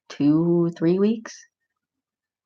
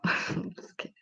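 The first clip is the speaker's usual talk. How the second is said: laughing whisper; 14 dB quieter than usual. Real, not acted. Teasing after denying being loud.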